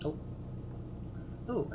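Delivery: usual speaking voice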